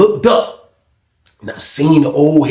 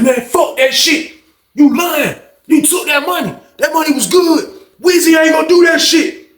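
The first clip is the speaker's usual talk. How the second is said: angry argument; 12 semitones higher than usual. Retold or imitated. Arguing back.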